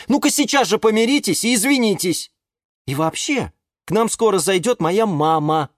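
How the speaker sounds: high, earnest reproach; high-pitched chiding pep talk; exasperated owner. A narrator's reading. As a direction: lecture squabbling friends. Owner lectures his plants.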